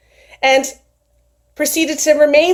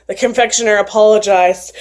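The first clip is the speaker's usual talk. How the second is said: ordinary delivery, nothing marked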